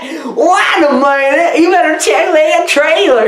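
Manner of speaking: high, hammed-up Southern old-timer; funny Southern accent. Old guy warning about the trailer, comic Southern accent. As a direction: put on a comic Southern twang. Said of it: "He has a funny accent".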